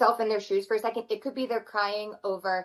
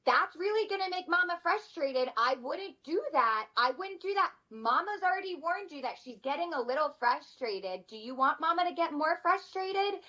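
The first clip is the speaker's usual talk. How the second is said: put-on much higher, bossy little-kid warning; imitating older sibling; 7 semitones higher, 3 dB quieter than usual. Older child warning younger sibling about mama, high bossy kid voice. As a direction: play the big sister scolding the little one. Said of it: "my older ones to my younger ones will be like".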